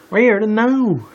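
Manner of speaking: loud, high Southern outburst; Southern accent. Acted. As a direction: call out as a Southern cowgirl. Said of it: "The Southerner stepped".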